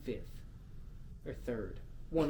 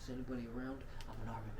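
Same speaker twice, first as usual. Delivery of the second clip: mock timid pretending; 2 semitones lower, 11 dB quieter, much faster than usual. Mocking a friend alone at paintball, hushed pretending. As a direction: murmur it as if playing alone.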